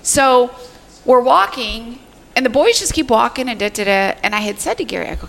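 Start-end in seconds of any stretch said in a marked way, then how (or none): none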